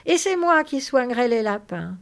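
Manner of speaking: loud, high, eager exclamation; thrilled. Acted. Lénie claims the rabbits for himself, thrilled. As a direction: claim it with childlike excitement.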